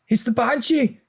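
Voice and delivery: very high, urgent cry; alarmed. A narrator's reading. Big brother sees the Banshee, high alarmed voice. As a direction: spot the monster in alarm.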